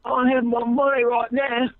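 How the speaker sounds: mock British accent, odd threat; mock British gangster. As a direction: parody a London gang threat. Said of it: "Over there".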